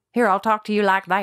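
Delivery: loud Texan twang; lightly put-on Texan accent. Shown as a demonstration. Woman showing the host how to do Texan, twangy. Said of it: "Do Texan".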